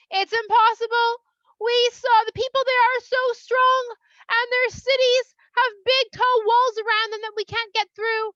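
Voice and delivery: high, loud, insistent panic report; alarmed spies. Acted. Spies warning the people against entering Israel, alarmed. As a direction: draw out the key words in hammed-up alarm.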